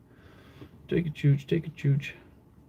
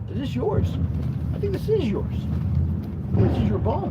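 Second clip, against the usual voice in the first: high, sing-song voice for the dog; pet talk; 7 semitones higher than usual. Real, not acted. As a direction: coo at the puppy with a bone. Owner baby-talking her dog.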